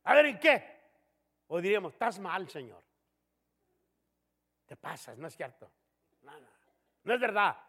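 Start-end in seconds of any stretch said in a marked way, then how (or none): 0.0-0.8 higher, louder, cheeky "A ver, ¿en qué?"; defiant challenge. Retold or imitated. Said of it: "como de veras". 1.9-7.6 mild insolent retort. Retold or imitated. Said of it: "contestando déspotamente".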